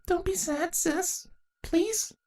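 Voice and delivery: put-on very high, soft childlike pleading; pleaded. Little sister softly pleads with crying Saronis. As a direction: coax your big sister gently. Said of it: "Puelula pleaded".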